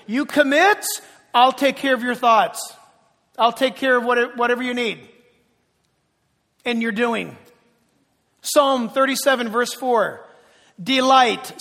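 loud, driving pulpit delivery; preaching at full force. Acted. Preacher driving the verses home to the congregation, full force. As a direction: preach each verse out to the room.

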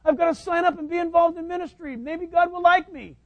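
mock worried believer. Mocking an anxious believer.

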